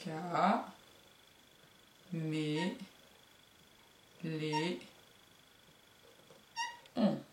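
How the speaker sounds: "Ca, mé, lé, on" split and soft; separated syllables. Shown as a demonstration. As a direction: tap out each syllable quietly. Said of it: "nombre de syllabes".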